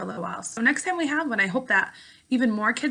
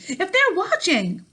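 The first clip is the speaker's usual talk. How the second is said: frightened scream; 10 semitones higher, 6 dB louder, slower than usual. Acted. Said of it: "she screamed".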